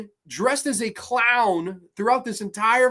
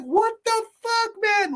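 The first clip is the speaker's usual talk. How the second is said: much higher, stunned cry; flabbergasted high-pitched what the fuck; incredulous. Retold or imitated. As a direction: squeal your disbelief at the screen. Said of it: "I was like".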